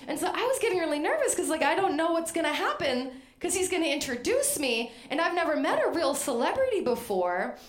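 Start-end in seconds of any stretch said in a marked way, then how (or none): none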